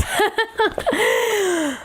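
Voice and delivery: high-pitched real laugh. Real, not acted. Host admits her impatience to viewers, high laugh. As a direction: laugh at your own admission.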